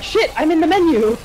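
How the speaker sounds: high, loud flustered cry of alarm; hammed-up frantic yelp; mock panic. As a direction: blurt it like you just hit the wrong key mid-fight. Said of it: "I'm like".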